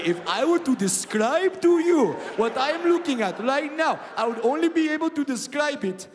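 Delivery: put-on loud, high, thrilled calling in a light accent; excited calling out. Sniper calls buddy Ahmed over, loud and thrilled. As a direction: call your buddy over in wonder.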